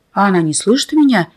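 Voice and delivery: usual speaking voice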